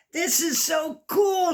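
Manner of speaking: put-on high, happy shout of a boy; boy's delighted cry; excited shout. A narrator's reading. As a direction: shout it out with glee. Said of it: "shouted Oliver".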